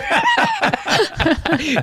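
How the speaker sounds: sudden high laugh; high burst of laughter. Real, not acted. Recalling the lemon trade, bursting into laughter. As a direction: let a big laugh burst out.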